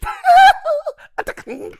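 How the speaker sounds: very high, loud giggle like a star; high squealing laugh imitation. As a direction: mimic a shrill high-pitched giggle. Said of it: "I'd never heard his laugh before".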